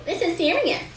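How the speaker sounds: high-pitched, nasal mimicry of her sister; mock her sister in a whiny voice; mocking imitation. A narrator's reading. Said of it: "high-pitched and nasal".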